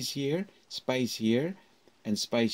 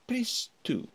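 "press two" lifted higher with a roll; 12 semitones higher than usual. Shown as a demonstration. Teacher demonstrating a phone-menu option. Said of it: "there's a roll again of my voice".

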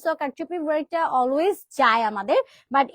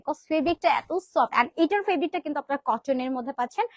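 ordinary talk, nothing marked

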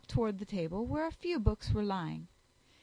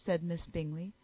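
ordinary delivery, nothing marked

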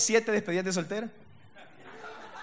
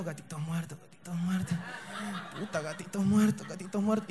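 muttered mantra; 3 semitones lower, 6 dB quieter than usual. Retold or imitated. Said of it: "y ahí estaba yo".

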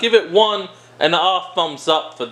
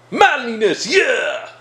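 hammed-up he-man shout; higher, exaggerated manly battle cry; mock macho cheer; 5 semitones higher, slower than usual. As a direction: roar it like a parody tough guy. Repeats mock macho sign-off.

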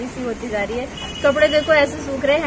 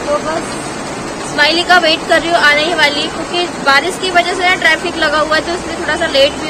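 raised voice, louder and higher, to be heard over the downpour; speaking loudly over rain; 3 semitones higher, 6 dB louder than usual. Real, not acted. Mother vlogging at the window over loud rain, speaking up loudly. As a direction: project the voice over heavy rain outside. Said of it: "ज़ोर ज़ोर से बोल रही हूँ मैं".

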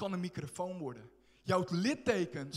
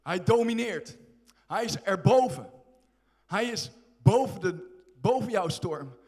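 loud, rising proclamation, he rules the storm; preaching crescendo; 6 dB louder than usual. Acted. Preacher to congregation, loud triumphant declaration. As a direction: drive it home loud and strong.

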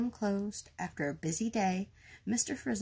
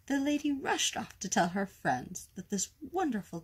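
ordinary delivery

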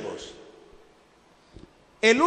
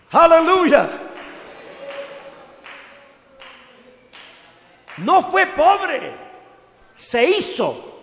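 loud, higher, proclaiming; fiery preaching; 5 semitones higher, 4 dB louder than usual. Acted. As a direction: preach it out to the whole church. Preaching at full force. (predicación encendida, fuerte y aguda)

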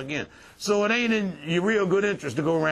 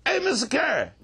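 higher-pitched hail to someone; calling out; 7 semitones higher than usual. Acted. Someone behind him in the street, calling out. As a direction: call out a name to get attention.

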